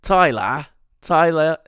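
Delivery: loud, slowed New Zealand accent imitation; Benny. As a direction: test out a friend's Kiwi pronunciation, loudly. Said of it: "trying to think of how fucking Benny says my name".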